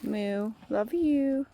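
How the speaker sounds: ordinary talk, nothing marked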